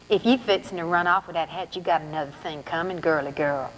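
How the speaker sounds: lightly voiced Southern saleslady; twangy Southern accent. Saleslady warns the girl in Southern accent. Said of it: "She's got that twangy voice".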